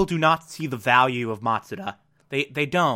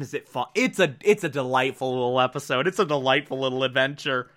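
normal talking voice

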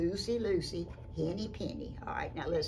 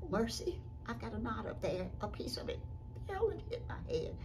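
slightly higher, soft storybook hen; lightly voiced hen; 6 semitones higher, 4 dB quieter than usual. Henny Penny feels the knot, lightly voiced. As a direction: gasp and puzzle it out as the hen. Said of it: "she said".

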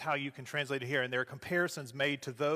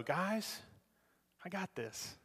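reassuring Jesus; 3 semitones higher, 8 dB quieter, slower than usual. Retold or imitated. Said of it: "he's letting them know".

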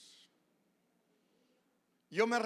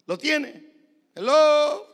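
high, loud prod to the congregation; loud, insistent check for attention; calling out; 10 semitones higher, 17 dB louder, much slower than usual. Real, not acted. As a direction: call out as if nobody is answering. Prods congregation to find the Psalm.

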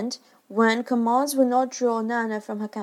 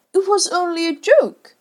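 roared; 9 semitones higher, 8 dB louder than usual. A narrator's reading. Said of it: "he roared".

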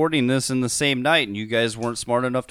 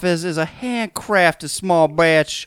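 southern plantation owner; 2 semitones higher, 4 dB louder than usual. Shown as a demonstration. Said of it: "you have morphed again to".

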